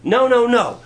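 Jesus firmly refusing the disciples; firm refusal. Retold or imitated. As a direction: shut them down. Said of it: "he said".

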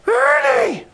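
very high, far-off cry of a name; high joyful old-man voice calling Ernie; old man's greeting. Retold or imitated. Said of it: "and he said to me".

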